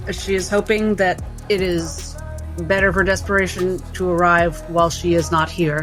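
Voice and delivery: ordinary talk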